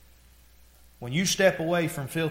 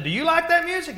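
high, loud question over music; imitated shouting over a stereo; retold holler; 13 semitones higher, 6 dB louder than usual. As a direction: holler it across to another car. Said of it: "I hollered at him".

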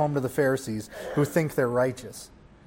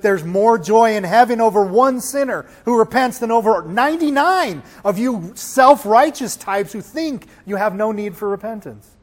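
raised, higher preaching voice; emphatic rebuke; 5 semitones higher, 5 dB louder than usual. Retold or imitated. Preacher as Jesus telling the Pharisees, emphatic rebuke. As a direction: throw it at the self-righteous. Acted retort to self-righteous Pharisees.

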